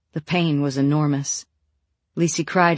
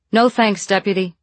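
calling out; 5 semitones higher, 5 dB louder than usual. A narrator's reading. Calling back up.

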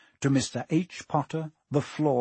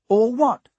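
put-on high, puzzled boy's question; 8 semitones higher, 8 dB louder than usual. A narrator's reading. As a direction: ask it loudly, all innocence. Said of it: "asked Harry".

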